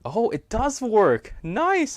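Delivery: high, loud, drawn-out delight; delighted surprise. Real, not acted. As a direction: exclaim it, thrilled that it finally works. Delighted the translator finally works.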